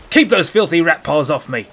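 loud angry guard shout. A narrator's reading. Guard shoves the boy off, loud shout. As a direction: bark him off your sash. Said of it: "the guard shouted".

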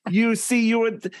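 put-on high, pedantic German-accented lecture; mock German accent.

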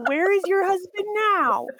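high-pitched, challenging comeback; mock angry retort. To widowed grandma pushing marriage, mock angry retort. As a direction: throw it back in mock outrage.

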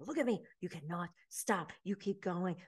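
soft, intense coaching; imitated hushed hyping up. Instructor crouching with nervous recruits, hushed hype. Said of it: "kinda hyping them up".

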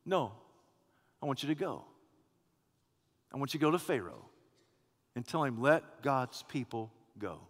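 low, hushed voice of God; a quiet, solemn divine command. Acted. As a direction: speak as God, low and soft.

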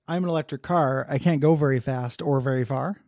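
ordinary delivery

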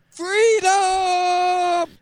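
very high, long drawn-out cry; mock yell. Imagining the wall's escape, hammed-up drawn-out yell. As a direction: yell it out, stretching the last syllable. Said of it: "imagining this wall yell".

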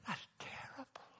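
hushed, shocked whisper; retold whisper of a shocked young man. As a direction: whisper it as if appalled.

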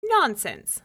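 high, loud scoff; dismissive wife. A narrator's reading. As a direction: brush it off sharply.

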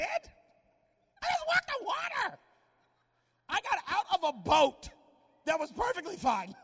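hammed-up outraged Peter; indignant Peter. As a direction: protest like offended Peter. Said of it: "I'd have been like".

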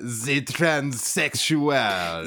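slow, hammed-up French accent; fake French accent. Joking podcast friend voicing a French cartoon character, in hammed-up French. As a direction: play a smug French cartoon villain.

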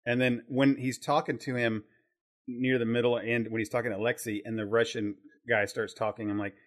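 normal talking voice

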